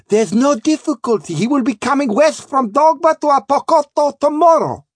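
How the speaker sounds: put-on very high, foreign-accented trader; placating Sanchez. Sanchez promising the king's arrival, placating trader. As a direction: reassure the captain in a high, eager voice.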